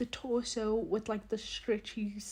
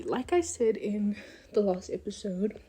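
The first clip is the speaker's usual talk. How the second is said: ordinary delivery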